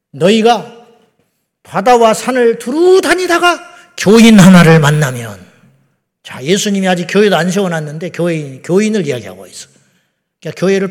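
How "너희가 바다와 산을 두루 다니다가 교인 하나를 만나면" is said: loud, slow scripture declamation; ringing pulpit quotation; preached rebuke. Acted. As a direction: stretch the words out as you proclaim them. Quoting Jesus' rebuke to Pharisees.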